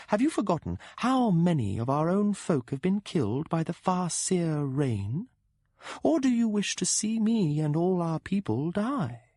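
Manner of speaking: alta, distante e lenta, accusatoria; voce della donna, rimprovero. Acted. Cat-rider woman rebukes.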